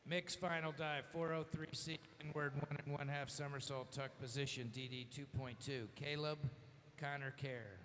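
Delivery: announcer over the pool PA; echoing PA announcement; diving announcer echo. Real, not acted. PA announcer reads dive.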